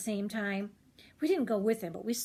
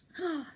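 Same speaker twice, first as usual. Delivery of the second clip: mock gasp; 8 semitones higher than usual. Reaction to price.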